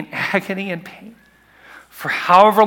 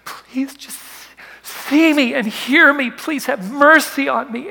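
pleading cry; 10 semitones higher, 3 dB louder, slower than usual. Retold or imitated. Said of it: "he's just been screaming".

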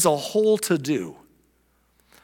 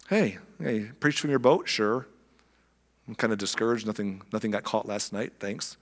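lower, softer weary reply; discouraged; 6 semitones lower, 6 dB quieter than usual. Acted. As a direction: let the disappointment sink the voice. Peter discouraged after empty night.

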